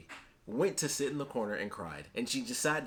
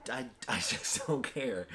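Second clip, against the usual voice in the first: laughing; slower than usual. Real, not acted.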